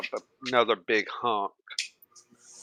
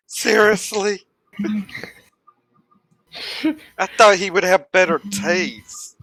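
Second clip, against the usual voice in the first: incredulous; 7 semitones higher, 5 dB louder than usual. Acted. Priya roasts Kilgara's boyfriend.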